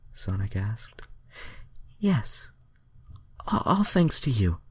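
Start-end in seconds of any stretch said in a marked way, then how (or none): 2.0-4.6 put-on high, breathy-free girly alien voice; hammed-up high heroine voice; high Starfire voice. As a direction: play her dreamy and high. Reader voices Starfire's dialogue.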